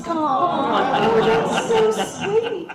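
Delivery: high, slow, laughing gush of delight. Retold or imitated. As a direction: melt over a sweet gift. Touched by fan's gift.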